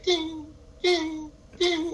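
high, steady chime imitation; imitated car chime. Car warns the crying driver about keys, imitated chime. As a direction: ring it out like a key-in-ignition alert.

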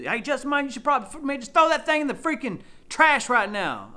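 mock a preachy viewer; mocking critic. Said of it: "Somebody probably wants me to".

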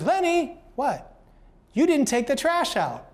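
mock annoyed wife catching him out; exasperated scolding.

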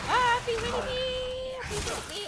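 high shrieking plea, very loud; panicked scream. Real, not acted. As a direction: scream in terror. Monster attacking, screaming.

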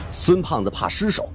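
plain reading voice

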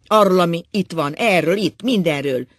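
loud outburst. A narrator's reading. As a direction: pour it all out loudly. Drunk husband's outburst.

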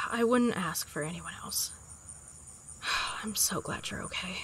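soft sighing relief; relieved whisper. Acted. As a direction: breathe it out gently.

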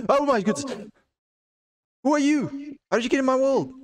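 loud, very high startled shriek; shocked yelling. Real, not acted. Gamer finding an intruder in the world, shocked yelling. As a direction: voice leaping up in alarm.

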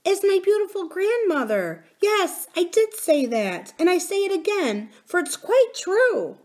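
put-on a child standing by the truth; little princess. A narrator's reading. Princess holds her ground.

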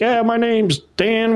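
put-on high, slow, drawn-out Southern accent. Recruit introducing himself, in a Southern accent. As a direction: mimic a tall Southern umpire's Southern accent. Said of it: "He's got a Southern accent".

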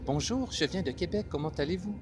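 very high, lilting French of France; France French accent. Shown as a demonstration. Contrasting France with Québec for learners, high-pitched French accent. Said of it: "c'est différent from France".

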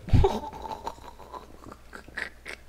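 high wheezing laugh; burst of laughter. Real, not acted. Loud burst at last place.

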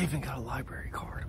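soft, voiceless library whisper. Real, not acted. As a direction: whisper it so nobody in the library hears. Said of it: "I gotta be quiet".

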